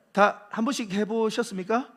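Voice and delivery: normal talking voice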